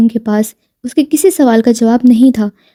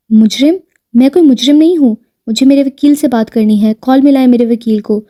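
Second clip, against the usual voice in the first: usual speaking voice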